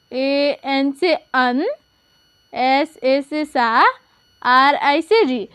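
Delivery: slow letter by letter; patient teacher spelling. Shown as a demonstration. Teacher slowly spells a name for pupils. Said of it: "तो देखिए".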